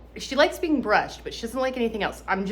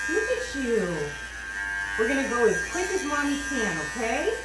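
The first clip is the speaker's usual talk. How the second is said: squeaky praising a dog; 4 dB quieter than usual. Real, not acted. Praising the dog during grooming.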